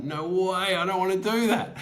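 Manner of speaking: high-pitched mock protest. Teller balks at the voice in his head, high protest.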